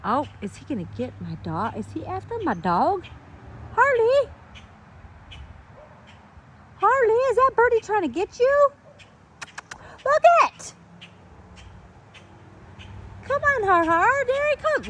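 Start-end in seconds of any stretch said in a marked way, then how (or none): 3.7-14.9 sing-song pet voice, much higher and louder; high cooing voice for the dog; high dog-talk voice. Real, not acted. Baby-talking to her dog.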